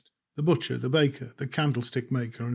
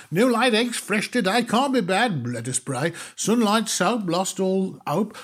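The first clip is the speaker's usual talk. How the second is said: higher, louder patter of a pushy grocer; hammed-up sales patter; 6 semitones higher, 3 dB louder than usual. Grocer shopman pushing groceries on the customer, pushy patter. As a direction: rattle the list off like a market hawker.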